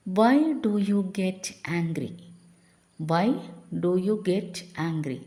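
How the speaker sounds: very slow, word by word; slow dictation. Shown as a demonstration. Teacher reading the English prompt slowly to the class. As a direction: stretch every word out. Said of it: "slow English model phrase".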